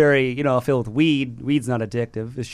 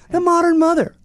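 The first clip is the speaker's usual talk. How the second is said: much higher, hammed-up pitchman; mock ad slogan; 13 semitones higher, 3 dB louder than usual. Pretend ad man naming the target customer, mock grandeur. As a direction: lift it high like a grand tagline.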